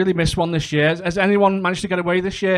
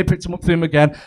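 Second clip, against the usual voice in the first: ordinary talk, nothing marked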